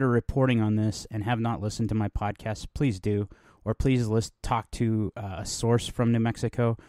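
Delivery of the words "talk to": ordinary talk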